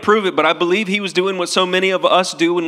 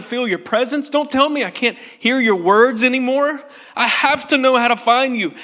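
higher, anxious plea to his teacher; pleading Thomas; 6 semitones higher than usual. Acted. Preacher voicing Thomas begging Jesus not to leave, higher and urgent. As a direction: beg him not to leave you.

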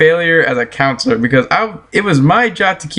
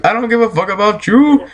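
higher-pitched, defiant-sounding insistence; emphatic repeat; 6 semitones higher than usual. Real, not acted. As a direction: pitch jumping up, rising on YOU.

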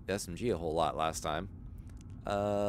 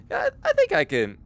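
normal talking voice

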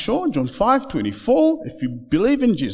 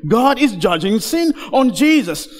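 ordinary talk

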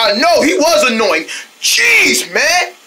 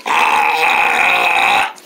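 ordinary talk

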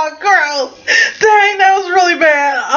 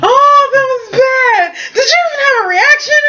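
laughing; 10 semitones higher than usual. Real, not acted. Laughing at the bad bean.

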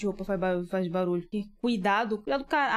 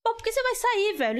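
exasperated; 14 semitones higher than usual. Real, not acted. Yelling at game character.

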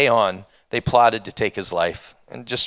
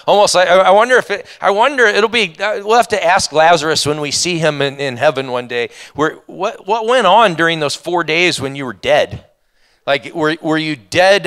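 higher and louder, bursting with curiosity; can't wait to ask Lazarus, voice up and loud; excited wondering; 5 semitones higher, 6 dB louder than usual. Real, not acted. Wondering aloud about Lazarus.

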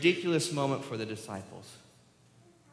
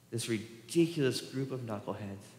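ordinary talk

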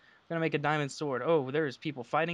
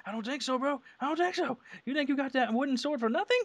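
high, squeaky mocking voice, climbing higher each time; mocking taunt; 10 semitones higher than usual. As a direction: squeal it out to taunt the other player. Taunting enemy player in game.

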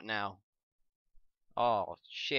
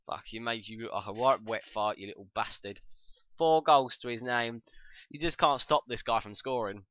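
ordinary delivery

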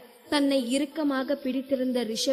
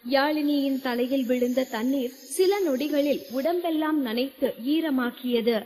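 ordinary talk

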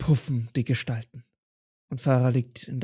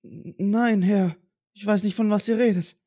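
timid servant; 6 semitones higher than usual. Acted. Farah timid before master.

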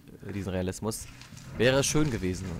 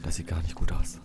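whisper; 6 semitones lower than usual. Real, not acted. Tense horror game moment.

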